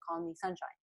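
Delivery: ordinary delivery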